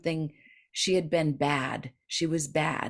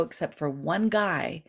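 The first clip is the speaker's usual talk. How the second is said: ordinary talk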